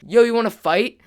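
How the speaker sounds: loud, high mock-tough challenge; hammed-up imitation of a wannabe fighter; cheesy challenge. Rival challenges the friends to fight, imitated cheesy bravado. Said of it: "said something really cheesy like".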